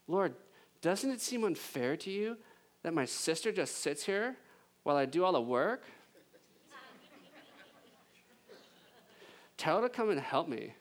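whiny Valley Girl, lightly voiced. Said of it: "It's a Valley Girl voice".

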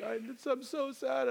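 put-on high-pitched, tearful little girl; mock a small child crying through the words; imitated child sobbing. Daughter upset in the car, imitated sobbing voice. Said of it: "she just starts sobbing".

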